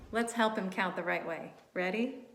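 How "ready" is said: usual speaking voice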